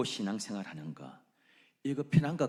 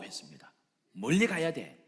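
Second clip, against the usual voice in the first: ordinary delivery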